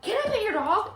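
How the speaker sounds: put-on high, loud eager kid voice; a child rushing in, squealing the question; excited kid. Mimicking kids who run at the dog, high and loud. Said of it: "so many kids just run up to her, like".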